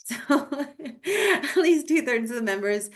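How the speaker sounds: talking through a laugh. Real, not acted. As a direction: chuckle your way into the sentence. Laughs after aside about member.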